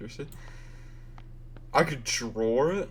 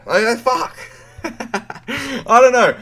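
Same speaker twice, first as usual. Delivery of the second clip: laughing; 11 semitones higher, 9 dB louder, slower than usual. Real, not acted. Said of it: "laughing at own failed attempts".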